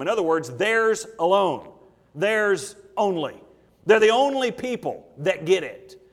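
slow, weighty preaching with key words punched; emphatic preaching. Real, not acted. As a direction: preach the verse deliberately, hammering key words. Preacher drives the point home.

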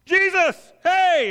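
high loud call; shouting from shore. Retold or imitated. Crowd chasing the boat, high loud shout. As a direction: holler after the boat. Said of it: "the crowd running alongside".

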